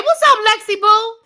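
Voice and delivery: high, loud, sing-song put-on greeting; mock sweet greeting. Vlogger mocks a cheery greeting to Alexis, high sing-song. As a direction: gush it like a fake-sweet hello.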